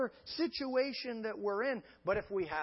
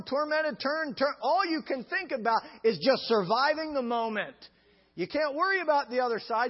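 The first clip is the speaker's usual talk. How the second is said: loud, high fervent preaching; preaching at full force; 4 semitones higher, 5 dB louder than usual. Real, not acted. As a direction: drive it home to the congregation.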